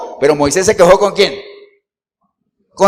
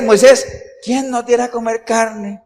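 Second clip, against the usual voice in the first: sarcastic whine; 5 semitones higher than usual. Retold or imitated. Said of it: "uno puede caer ¿en qué? En el sarcasmo".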